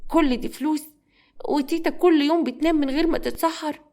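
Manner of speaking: put-on subdued, sorrowful child; tearful young boy. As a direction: marvel sadly to yourself as a little boy. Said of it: "دموعه بتنزل".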